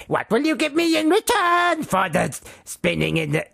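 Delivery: high-pitched bargaining little gnome; exaggerated fairy-tale imp. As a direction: pipe up high like a scheming gnome. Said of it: "shall I carry on doing the Rumpelstil".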